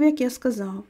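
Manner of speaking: plain reading voice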